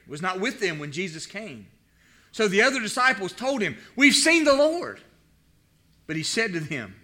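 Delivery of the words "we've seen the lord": higher, louder, eager telling; excited report. Acted. Disciples telling Thomas, excited. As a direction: blurt the news out eagerly.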